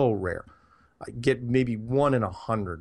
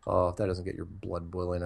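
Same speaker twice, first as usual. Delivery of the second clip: ordinary delivery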